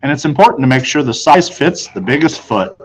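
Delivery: ordinary delivery, nothing marked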